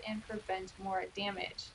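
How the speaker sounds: ordinary talk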